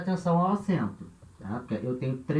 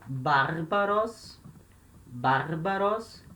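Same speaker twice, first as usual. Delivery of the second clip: very slow, drawn-out model word; teacher sounding out vocabulary slowly; slow dictation; much slower than usual. Shown as a demonstration. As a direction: stretch each syllable for students to copy.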